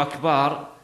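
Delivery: أكبر said "akbaar", long second vowel; lengthened ba. Shown as a demonstration. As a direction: draw out the vowel after the b in أكبر. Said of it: "الباء". (مدّ الباء في «أكبر»)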